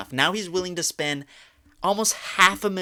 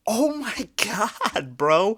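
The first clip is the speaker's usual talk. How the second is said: slow, much higher drawn-out gasp of words; aghast; 6 semitones higher, much slower than usual. Real, not acted. Reading the fan's message, slow aghast disbelief. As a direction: let the disbelief stretch out.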